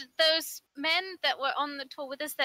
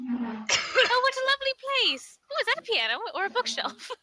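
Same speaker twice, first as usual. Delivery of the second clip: high, fast gushing; excited; 8 semitones higher than usual. Pretend. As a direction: gush over a cosy room. Player gushes over cabin.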